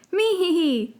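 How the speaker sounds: high squeaky little creature giggle. Acted. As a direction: giggle like a tiny fluffy pet. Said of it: "the puffskin repeated".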